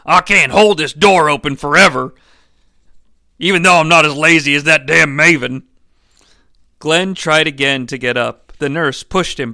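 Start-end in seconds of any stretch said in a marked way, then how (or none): 0.0-5.6 loud, impatient yell. Acted. Mark holding the door for Glenn, impatient yell. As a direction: holler from the doorway in character. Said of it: "Mark yelled".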